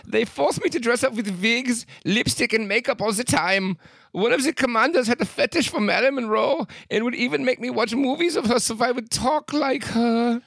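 high-pitched mock German accent. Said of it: "saying this in a German accent".

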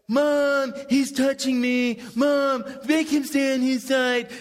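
put-on high, slow whining kid shout; whiny tattling little sibling. Kids fighting in minivan.

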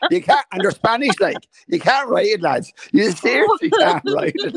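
loud, high, laughing protest; really tickled, voice climbing; laughing disbelief. Real, not acted. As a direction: crack up while insisting on it. Cracking up at unbelievable story.

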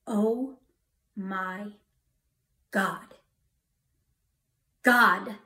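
word by word with long pauses; slow, deliberate calling on God, not shouted; awed, sobered exclamation. Retold or imitated. Newly sober driver calling on God, slow awe. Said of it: "I yelled".

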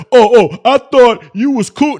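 high, loud sarcastic whine; a loud mock of someone's whiny excuse; mocking whiny quote. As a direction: mimic the chatter's fake innocence. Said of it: "trying to say".

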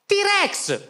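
put-on high, bursting child's cry; kid's eager shout. Child answering the entertainer, high eager shout. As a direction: blurt it out like an overexcited kid.

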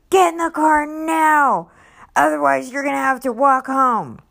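high, loud barked order; angry ultimatum. Retold or imitated. Imitating the dad yelling at his family to get in, furious. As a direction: shout the threat from the car.

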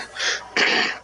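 throaty clicking creature noise; Predator impersonation. Shown as a demonstration. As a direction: imitate Predator's rattling clicks. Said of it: "This is my Predator impersonation".

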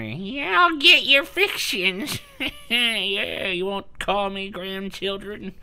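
put-on high, slow old granny voice; old lady voice. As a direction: croak it out like a grumpy grandma. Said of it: "this old lady just sitting in the corner".

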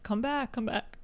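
hiccups breaking the words; hiccuping. Real, not acted. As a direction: talk through hiccups. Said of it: "I'm hiccuping into the mic".

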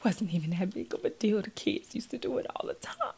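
breathy, hushed self-pity, imitating crying; a hammed-up sob-whisper of regret; mock tearful whisper. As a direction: whimper it like you've been crying over him. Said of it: "crying like".

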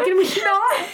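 laughing. Retold or imitated. Cringing at TikTok flirting.

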